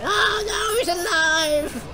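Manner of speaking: high shout, then slow; alarmed shout. Real, not acted. Player seeing the boss get back up, alarmed shout. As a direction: yell in dismay.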